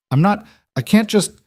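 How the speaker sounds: sudden high panicked protest; breaking off in alarm, pitch jumping up; panicked stammer. A narrator's reading. Lena protesting the man's order in panicked stammer.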